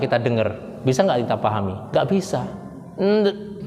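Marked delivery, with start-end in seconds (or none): 2.9-3.7 "Nde-" as a stuck, muffled hum; lafaz tidak keluar. Shown as a demonstration. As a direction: try to speak without letting the word out. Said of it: "nah, misalnya". ("Nde-" tertahan, lafaznya tidak keluar)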